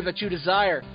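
ordinary talk, nothing marked

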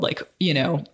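ordinary talk

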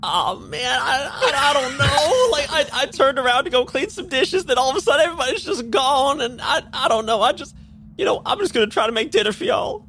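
very high, fretful voice; worried little bartender; high nervous bartender. Acted. Bartender voiced in an rpg scene.

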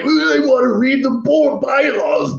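high, slow, mocking shouted whine; mocking whiny board member. Mocking board members in a meeting, shouted high and slow. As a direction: mimic a pedantic member demanding the bylaws. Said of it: "And you people are like".

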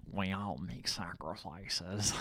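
low, slow, hushed mock-ominous line; imitating a cryptic villain, very quiet. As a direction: intone it softly and slowly, like a riddle. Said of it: "he responds cryptically".

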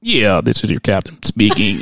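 low, slow, loud cockpit announcement; drawn-out captain's voice; airline captain. Retold or imitated. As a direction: parody a pilot on the intercom. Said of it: "the pilot goes".

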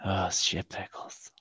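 breathy whispered exclamation. Acted. Friend on a call whispers the silly phrase. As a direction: whisper it slowly, savoring it.

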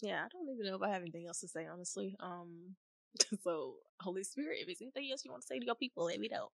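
very soft, spent voice; subdued. Real, not acted. Speaker, emotionally spent, asks the Holy Spirit softly. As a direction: speak softly, still recovering.

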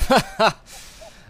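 loud, high sinister chuckle; villain laugh. Acted. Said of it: "starts to laugh".

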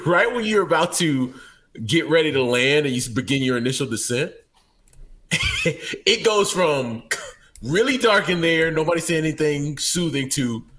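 ordinary talk, nothing marked

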